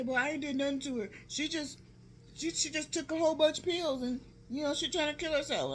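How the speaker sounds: high-pitched insistent protest; imitated defensive excuse. Uncle blaming the pills to auntie, imitated defensive protest. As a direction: mimic him pleading innocence at high pitch.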